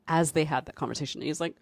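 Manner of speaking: ordinary talk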